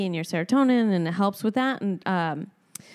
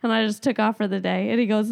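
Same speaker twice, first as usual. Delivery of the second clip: normal talking voice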